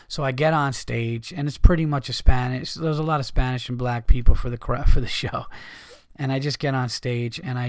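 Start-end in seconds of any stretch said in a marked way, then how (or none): none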